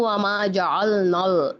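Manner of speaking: slow जअलनल with heavy "ol, nol"; tongue tip only, dark L in जअलनल; thick lam demo. Shown as a demonstration. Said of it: "अगर मैं इसको पढ़ूँ".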